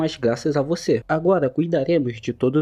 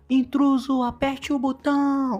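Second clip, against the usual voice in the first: shouted alarm; 9 semitones higher, slower than usual. Acted. Guards yelling to press the alarm button. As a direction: raise the alarm at full pitch. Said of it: "os caras gritam".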